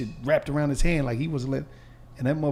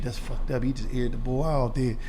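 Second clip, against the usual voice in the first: lightly deepened, softer voice of a homie; a hint of a low, hushed inmate voice; deep voice; 5 semitones lower, 7 dB quieter than usual. Homie coming in, mildly deep and hushed. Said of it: "he said it with the deep shit".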